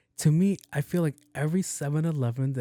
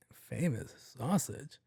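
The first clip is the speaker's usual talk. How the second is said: slow puzzled question; 8 dB quieter, much slower than usual. Retold or imitated.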